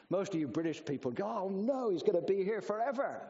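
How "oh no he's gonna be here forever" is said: higher-pitched, hammed-up groaning complaint; mock dismay. British churchgoers as the preacher removes his watch, mock dismay. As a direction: imitate a congregation dreading an endless sermon. Imitates British congregation dreading long sermon.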